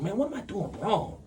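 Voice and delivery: high, soft plaintive question; puzzled self-questioning. Retold or imitated. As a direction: ask yourself in high, hushed bewilderment. Retells questioning himself in frustration.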